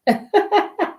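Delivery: short, high burst of laughter; light self-amused cackle. Real, not acted. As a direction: laugh at your own fumble. Laughing at own camera fumble.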